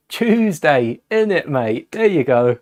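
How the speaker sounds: cheeky hammed-up Cockney British accent; mock Cockney. Vlogger proving his Britishness to viewers, in a mock Cockney accent. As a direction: toss it off like a London geezer. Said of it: "the Britishness through it".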